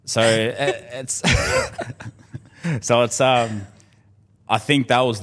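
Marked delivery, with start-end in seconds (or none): 1.3-2.1 emotional laugh. Real, not acted.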